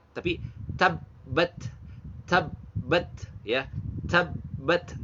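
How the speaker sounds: TABBAT slowly with a puff of breath on the final T; hamas ta. Shown as a demonstration. Tajwid teacher models tabbat for learners, breathy final T. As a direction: say TABBAT in two parts, ending on an aspirated T. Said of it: "ta itu hamas".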